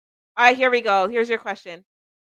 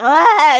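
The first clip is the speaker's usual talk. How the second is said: sudden cry out; 6 semitones higher, 8 dB louder than usual. Retold or imitated. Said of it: "it just went".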